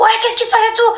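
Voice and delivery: high mock voice of a roused dad; groggy father. Woken father asking what's happening, high mock voice. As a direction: play dad grumbling what's going on.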